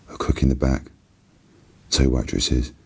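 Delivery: usual speaking voice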